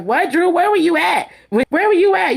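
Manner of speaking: high mock disbelief climbing; incredulous. Kandi disbelieving at Drew.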